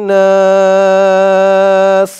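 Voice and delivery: slow, drawn-out chant, each vowel held long; Quranic recitation. Shown as a demonstration. Teacher chanting the verse for students, slow and drawn out. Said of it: "আবার বলুন আমার সাথে".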